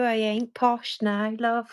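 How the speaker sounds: slow, broad Brummie accent; Birmingham accent. Shown as a demonstration. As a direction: lay the Birmingham accent on thick, slowly. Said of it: "I can do the Birmingham accent".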